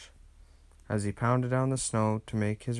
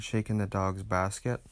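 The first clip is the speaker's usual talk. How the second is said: plain reading voice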